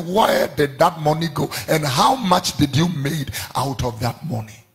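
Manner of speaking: normal talking voice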